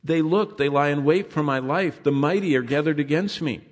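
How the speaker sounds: scripture reading. Acted. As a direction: proclaim the psalm from the pulpit. Preacher reads psalm aloud.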